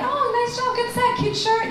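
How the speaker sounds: high-pitched festival small talk; imitated complimenter. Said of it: "they're like".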